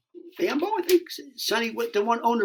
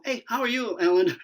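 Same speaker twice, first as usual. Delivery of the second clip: higher, polite phone voice; totally different voice; 5 semitones higher than usual. Retold or imitated. Actor answers casting caller, voice suddenly changed. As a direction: switch into a higher greeting voice. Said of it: "My voice, like, like, it's, like, totally different".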